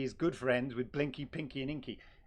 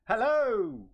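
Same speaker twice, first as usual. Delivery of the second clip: loud hello; 7 semitones higher, 8 dB louder than usual. Real, not acted. Greeting the new guest loudly.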